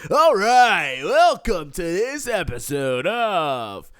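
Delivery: loud, high, slow show announcer. Acted. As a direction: boom it out like a game show host. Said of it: "Let me get my announcer voice on".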